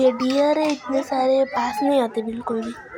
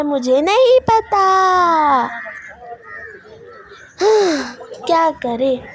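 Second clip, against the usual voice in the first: mock despair; 3 semitones higher, 4 dB louder, slower than usual. Real, not acted. Mother keeps taking videos instead.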